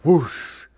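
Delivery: loud whooshing noise from the mouth. Acted. Hunter voices his attack as a loud whoosh. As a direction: blast out a whoosh like a swipe.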